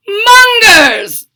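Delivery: high, loud shout for help; booming alarmed shout; mad scientist shout. Acted. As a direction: yell for the minions. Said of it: "Doctor Franken shouted".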